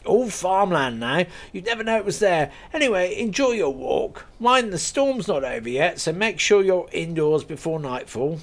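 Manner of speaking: a friendly landowner laughing off his joke; lightly voiced farmer. Gunman gives directions.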